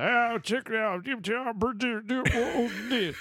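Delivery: high, slow hillbilly drawl with banjo hum; mock backwoods old man humming a tune; good old boy imitation. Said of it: "So I sound like the dude from".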